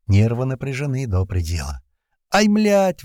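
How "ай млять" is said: high startled yelp; sudden cry. A narrator's reading. Redhead jolted by a shock, high yelp. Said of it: "внезапно вскрикнул Рыжий".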